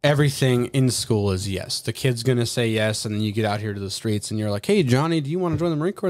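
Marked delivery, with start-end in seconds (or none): 4.6-6.1 put-on bright, high, salesy call to a kid; cheery recruiter pitch. Recruiter calling to a high school kid, cheery high pitch. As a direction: sell it like an eager recruiter, pitched up.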